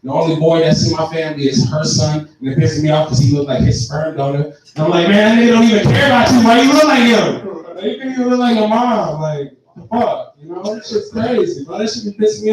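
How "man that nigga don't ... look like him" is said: loud, very fast angry rant. Retold or imitated. Ranting at the boy, loud fast fury. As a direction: fire it off in a loud rush.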